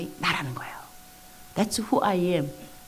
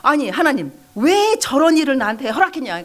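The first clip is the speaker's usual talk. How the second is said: high, loud huffy complaint; fuming protest; 6 semitones higher, 6 dB louder than usual. Retold or imitated. Believer back home complaining to God, fuming protest. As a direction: demand an answer, worked up and loud. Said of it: "막 씩씩거리는데 집에 가갖고".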